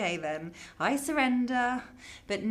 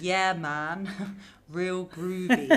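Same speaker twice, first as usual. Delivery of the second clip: giggly, slow put-on cool; slow, joking groovy with a laugh; laughing mock slang; slower than usual. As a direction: ham up the hip slang through laughter. Teasing mum with slang.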